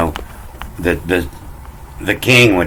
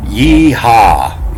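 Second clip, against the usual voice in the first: higher, louder yee-haw; cowboy holler; 5 semitones higher, 5 dB louder than usual. Acted. Jumping on your horse, a hollered yee-haw. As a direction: holler it like a rider setting off. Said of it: "jump on your horse and you holler".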